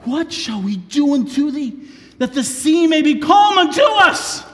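high, loud shout; desperate shout. Acted. Sailors shout to Jonah over the storm. Mariners shout their plea.

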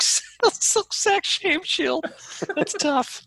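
very high, slow, wheezing through laughter; cracking up. Real, not acted. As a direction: struggle through a tongue twister while laughing. Cracking up at tongue twister.

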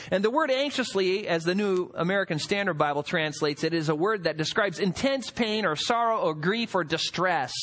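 ordinary talk

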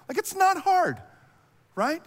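high-pitched, exasperated mock complaint; super irritated. Religious elite scolding officers, irritated. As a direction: whine it loudly in disbelief at their failure.

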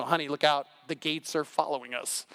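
a lightly hammed-up warning to the spouse; mock frightened spouse. Mock couple in car.

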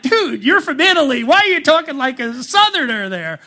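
incredulous. Retold or imitated. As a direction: tease a friend in amused disbelief. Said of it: "And I'm like".

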